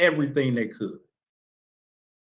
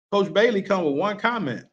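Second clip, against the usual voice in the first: ordinary talk, nothing marked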